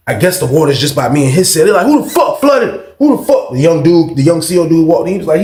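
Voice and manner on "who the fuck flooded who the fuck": high, indignant questioning; irate demand. Retold or imitated. Guards finding water on tier, irate demand. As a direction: imitate guards demanding answers, pitched up.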